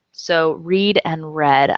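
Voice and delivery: "read" as reed, then "read" as red; slow contrast, reed then red; teacher contrasting slowly; two readings. Shown as a demonstration. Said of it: "familiar with this one".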